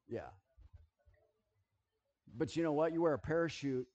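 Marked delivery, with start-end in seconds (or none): none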